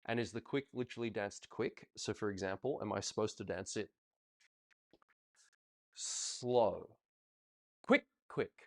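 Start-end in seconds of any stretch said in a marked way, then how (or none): 5.9-8.5 wrong way demo. Shown as a demonstration. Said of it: "Looks wrong, doesn't it?".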